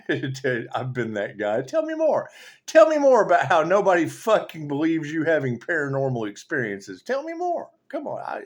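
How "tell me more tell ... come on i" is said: high, loud mocking repeat; sarcastic taunt. Sarcastic high taunt at someone who claims nobody believes them. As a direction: needle them with fake interest.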